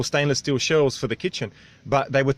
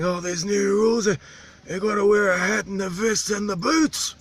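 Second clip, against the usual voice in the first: higher mimicked local voice; imitating the islander; 9 semitones higher than usual. Islander complains about longboat safety gear, higher voice. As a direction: poke fun at the new rules in his voice.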